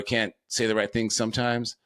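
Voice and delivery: ordinary talk, nothing marked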